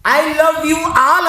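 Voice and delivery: high, loud, very drawn out; declaimed greeting. A narrator's reading. Teacher's daily words to the whole class, declaimed slowly. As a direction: stretch each word out loudly.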